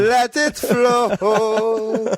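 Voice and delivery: very slow, high, stretched-out reggae croon; hammed-up reggae drawl urging his friend to sing; mock reggae singer. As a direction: draw every word out like a dreamy reggae man.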